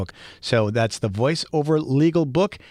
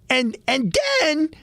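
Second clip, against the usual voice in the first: high and then. Retold or imitated. Builds to nephew's and then.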